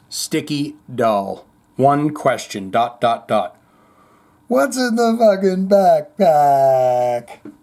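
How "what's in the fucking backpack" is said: drawn-out, high anguished movie yell; Brad Pitt in Seven. Acted. Host reading a question as the film line, anguished yell. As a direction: wail the question slowly, hammed-up. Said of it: "thinking about that fine movie Seven".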